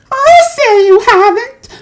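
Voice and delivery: very high, loud indignant cry. A narrator's reading. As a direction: cry out in outrage. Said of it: "cried Missus Beauregard".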